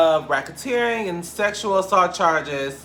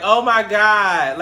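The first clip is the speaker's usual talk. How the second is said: retold crying; 6 semitones higher, 7 dB louder, much slower than usual.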